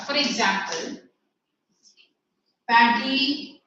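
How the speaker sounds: slow, word-by-word dictation; measured teacher dictation with long gaps; classroom dictation. Acted. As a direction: dictate slowly, pausing between phrases for pupils to write. Dictates the answer for writing.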